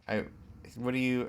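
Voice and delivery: ordinary talk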